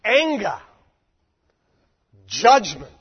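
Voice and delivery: loud proclaiming. Acted. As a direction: slam down the word anger. Preacher names symbols of wrath.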